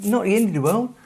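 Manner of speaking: Mark. Retold or imitated. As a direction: imitate a friend egging you on, pitched right up. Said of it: "Mark's going".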